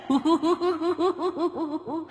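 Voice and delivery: high shrieking evil cackle; witch cackle. A narrator's reading. Ghost hag cackles down from the tree, shrill and gleeful. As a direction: let loose a long ghostly cackle. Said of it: "nó cười khanh khách".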